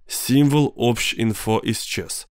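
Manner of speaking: ordinary delivery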